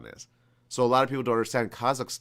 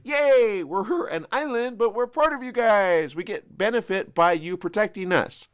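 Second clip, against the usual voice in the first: mock cheerful Hawaii; 10 semitones higher, 6 dB louder than usual. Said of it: "Hawaii was like".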